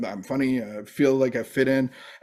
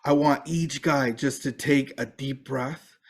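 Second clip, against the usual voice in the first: softer, slow and calming; calm instruction; 5 dB quieter than usual. Retold or imitated. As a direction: ease the room into a breath. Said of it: "He goes".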